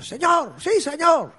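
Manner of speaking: high, loud barked yes sir; mock military reply. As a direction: shout it like a drilled recruit. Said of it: "y todo".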